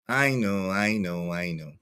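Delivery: low, hushed and trailing off; muttered aside. Real, not acted. Streamer conceding a point to himself, muttered.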